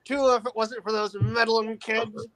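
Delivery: high, slow cartoon villain grumble; Scooby-Doo villain. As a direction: play the foiled cartoon crook. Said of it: "You sound like a villain from, uh, from Scooby-Doo".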